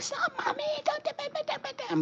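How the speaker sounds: very high, squeaky imitation of a small daughter; daughter's whiny yell. Mom imitates her daughter whining at her, in a squeaky high voice. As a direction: mimic the kid begging mommy to stop. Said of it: "she's gonna yell at me and be like".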